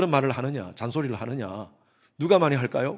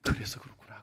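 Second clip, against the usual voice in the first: hushed, to himself; whispered realization; 8 dB quieter than usual. Retold or imitated. Pastor realizing why the students pray, whispering. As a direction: whisper it as it dawns on you.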